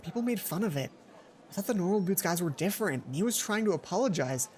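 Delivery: ordinary talk, nothing marked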